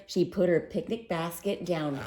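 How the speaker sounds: ordinary delivery, nothing marked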